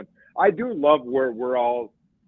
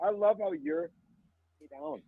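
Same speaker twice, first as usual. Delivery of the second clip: bad connection; 6 semitones higher, 12 dB quieter than usual. Real, not acted. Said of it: "you're breaking up real bad".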